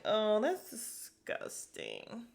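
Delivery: slow hammed-up revulsion; disgusted. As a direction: stretch it out, thoroughly grossed out. Retells disgust at cow mess.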